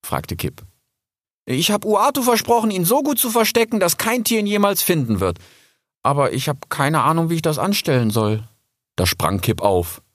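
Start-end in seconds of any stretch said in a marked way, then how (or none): 1.5-8.5 put-on worried young Rix.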